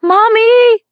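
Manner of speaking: very high, loud cry of mommy; yell for mommy. A narrator's reading. Yuki waking in the light, crying out for mommy, high and loud. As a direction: cry out like a frightened child. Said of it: "she yelled".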